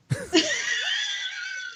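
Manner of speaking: very high laughing shriek. Real, not acted. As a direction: scream with laughter. Huge laugh.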